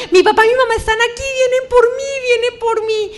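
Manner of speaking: high, loud cries, near tears; a terrified sister yelling that the parents have come; frightened shout. Retold or imitated. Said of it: "porque empezó a gritar". (grito asustado, agudo y fuerte, casi llorando)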